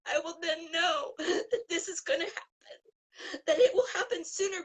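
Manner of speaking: fighting back tears while speaking; tearful. Real, not acted. Guest describing her dream to the host, tearful. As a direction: voice catching on real tears, laughing through them.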